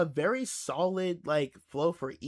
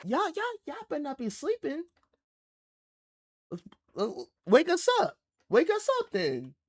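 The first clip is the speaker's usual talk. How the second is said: hype call-out; 9 semitones higher than usual. Real, not acted. Calling out sleeping viewers.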